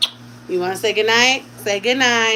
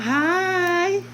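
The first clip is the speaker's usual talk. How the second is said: put-on high little baby "Hi" in a different voice; playful imitation of her son saying hi; imitating baby; 8 semitones higher than usual. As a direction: mimic the baby's tiny hello. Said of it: "he be looking at us talking about".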